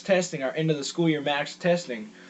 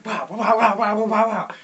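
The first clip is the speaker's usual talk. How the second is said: quick, higher, louder pow pow punch noises; mock punch sounds; 6 semitones higher, 4 dB louder than usual. Teen acting out the fight for viewers, punchy mock sound effects.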